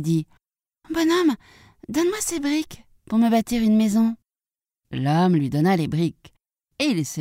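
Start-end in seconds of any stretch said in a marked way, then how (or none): 0.9-4.2 high piglet voice; little pig. Acted. Third pig asks a man for bricks, high piglet voice. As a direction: pipe up high as a piglet asking a favour.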